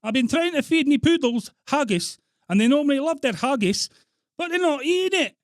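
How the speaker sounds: high, loud complaining Scot; hammed-up Scottish outrage on a prank call; Scottish accent. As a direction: wail the complaint in mock Scots. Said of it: "You putting on a Scottish accent?".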